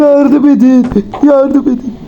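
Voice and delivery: high, insistent repeated cry; calling for help. Pretend. Stuck gamer begs friends for help, high wailing call. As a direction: keep crying out on a high note.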